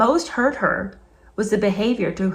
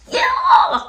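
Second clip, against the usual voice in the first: disgusted shriek; 7 dB louder than usual. Real, not acted.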